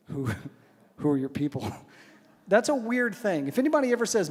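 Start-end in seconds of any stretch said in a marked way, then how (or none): 0.1-1.7 halting, cautious query; hesitant question. Retold or imitated. Husband puzzled by wife's talk of her people, hesitant. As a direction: feel your way into the question.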